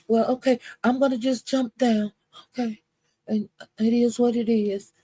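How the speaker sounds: lightly voiced meek giving in; [name] backing down.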